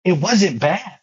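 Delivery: high, urgent, heartfelt; high intense confession. Real, not acted. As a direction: voice up high, earnest and pressing. Emotional confession, pitch high.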